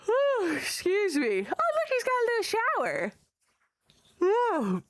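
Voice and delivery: high squealing delight; excited squeal. Real, not acted. Squealing over mascot shower.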